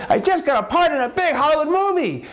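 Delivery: very high, thrilled squeal; hammed-up elated husband; giddy bragging. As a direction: blurt out great news in a squeaky pitch. Husband bursting with good news.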